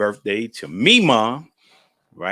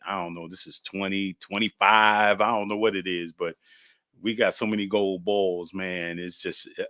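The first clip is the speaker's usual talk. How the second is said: ordinary delivery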